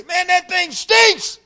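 high, loud, drawn-out disgust; disgusted complaint. Acted. Passerby at the pig pen, loud disgusted complaint. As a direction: complain loudly about the stench.

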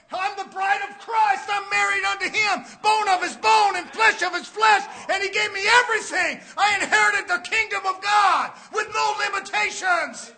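loud, high sermon shout; fired-up pulpit delivery; full-force preaching. Real, not acted. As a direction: preach it out at the top of your voice.

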